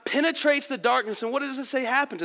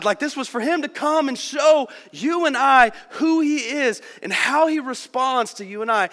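ordinary talk, nothing marked

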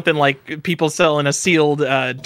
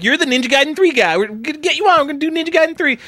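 excited Mike; 12 semitones higher, faster than usual. Retold or imitated. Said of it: "Mike was like".

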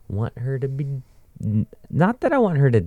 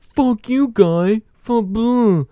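much higher, mock-dim insult; dumb insulter; 3 dB louder, much slower than usual. Mocking people who insult badly, high dopey voice. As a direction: play the thick guy with no words. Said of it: "They're like".

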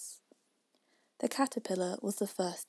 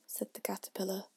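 usual speaking voice

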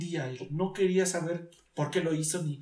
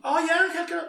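mock objection; 12 semitones higher, 6 dB louder than usual. Voices an imagined objection.

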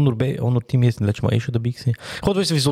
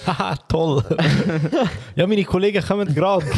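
laughing; 6 semitones higher than usual. Real, not acted. Laughing at friends arriving.

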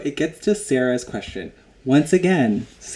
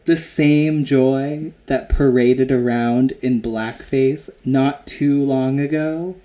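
slow pointed gotcha; 5 dB louder, slower than usual. Retold or imitated. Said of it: "she's like".

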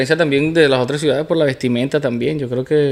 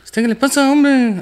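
imitating the sing-song Merida accent, much higher and rising; 10 semitones higher, slower than usual. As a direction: mimic a singsong Andean Merida speaker with high swooping pitch. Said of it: "el merideño habla más cantadito".

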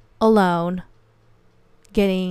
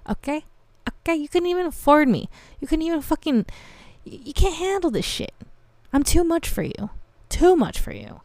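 high-pitched acted sass; retold haughty brush-off, pitched way up; sassy comeback; 8 semitones higher than usual. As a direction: put him in his place in a high voice.

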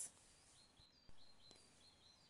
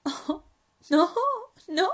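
little leopard; 10 semitones higher, 10 dB louder than usual. Acted. Cub amused by spider.

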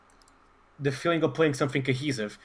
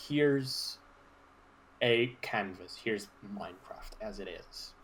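watery throat; 4 semitones lower, 7 dB quieter, slower than usual. Real, not acted. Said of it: "I've drank too much water".

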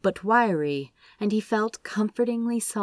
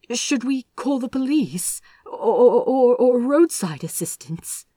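higher, faltering question; stammering panic; 6 semitones higher, 5 dB louder than usual. A narrator's reading. Aziraphale to the stranger, stammering in panic. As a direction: voice catching in distress.

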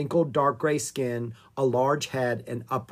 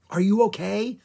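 mild, higher-pitched worried cry; concerned cry; 7 semitones higher, 3 dB louder than usual. A narrator's reading. Annie checks on Jack after the thorns, concerned cry. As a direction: call out in raised concern. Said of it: "Annie cried".

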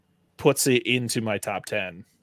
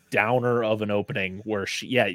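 usual speaking voice

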